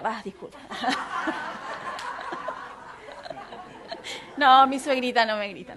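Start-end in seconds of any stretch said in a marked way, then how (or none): 0.6-4.3 long, breathy laugh; real laughter. Real, not acted. Preacher laughing after joking about mothers-in-law, long real laugh. 4.4-5.8 loud laughing denial. Real, not acted. Preacher retracting a mother-in-law joke, laughing.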